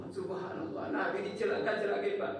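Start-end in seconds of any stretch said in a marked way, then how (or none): none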